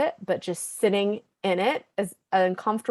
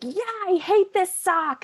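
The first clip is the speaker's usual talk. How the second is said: put-on high, whiny child voice; whiny kid; 11 semitones higher than usual. Daughter complaining about her sock, high and whiny. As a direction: whine it like a fed-up little girl. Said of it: "when she's like".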